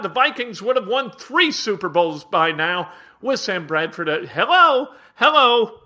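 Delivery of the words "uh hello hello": loud, higher hello into a dead line; puzzled loud hello, checking the line; calling out. Real, not acted. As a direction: call out to a caller who's gone. The guy hung up.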